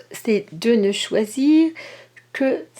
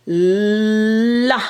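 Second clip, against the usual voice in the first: "la" with the l held and pushed; teacher dictating a syllable, consonant emphasised; stress on the consonant; 3 dB louder than usual. Shown as a demonstration. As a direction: lean on the l of "la" as a dictation cue. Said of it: "en insistant sur le, la consonne".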